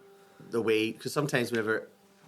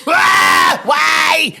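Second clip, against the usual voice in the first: high, loud anguished wail; hammed-up crying yell; demo angry cry shout; 16 dB louder than usual. Shown as a demonstration. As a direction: cry out why in a sob of rage. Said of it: "Do you know that sort of a way?".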